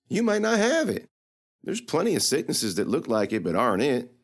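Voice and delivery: put-on teasing girl voice, pitched high; sassy young girl. Lily needling the knight about heat, teasing. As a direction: poke fun at the knight's logic.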